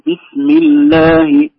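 slow, loud "الله" with the ل stretched too long; overheld doubled lam. Shown as a demonstration. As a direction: demonstrate dragging the doubled ل in "بسم الله". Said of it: "مش أقول". (إطالة لام "الله" خطأً)

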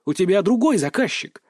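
plain reading voice

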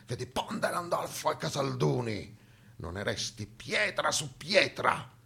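ordine lento, cupo e spietato; ordine del generale. Acted. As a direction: scandisci una condanna senza appello. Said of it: "il generale Cialdini ordinò". (Cialdini ordina a de Sonnaz la distruzione, freddo e lento)

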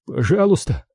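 pleading. A narrator's reading. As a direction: beg your captor for mercy. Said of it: "взмолился Кеннинг". (мольба, голос выше)

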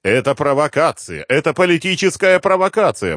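ordinary delivery

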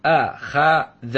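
أخذ split into slow a-kha-dha; each syllable of أخذ held apart. Shown as a demonstration. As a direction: sound out أخذ with gaps between syllables. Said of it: "على وزن فعل".

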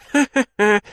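put-on high cackling old hag laugh; mocking laugh. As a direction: cackle right in his face. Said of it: "Дацуэба рассмеялась мне в лицо". (насмешливый старушечий хохот)

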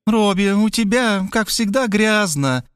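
put-on high, reproachful old mother; mother's nagging voice. A narrator's reading. Mother scolding son at the door, high and reproachful. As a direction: scold him like a fussy mother.

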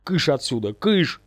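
mild shooing shout, jumping on the last word. A narrator's reading. Ghost chases intruders from his chest, shouting. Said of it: "закричал я".

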